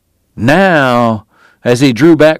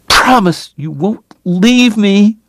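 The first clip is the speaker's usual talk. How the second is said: tearful plea; 9 semitones higher, slower than usual. A narrator's reading. Sobbing Kate pleads.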